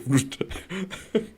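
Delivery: sudden high laugh at a silly claim; incredulous high laugh; high burst of laughter. Real, not acted. Reacts to Petty pro claim.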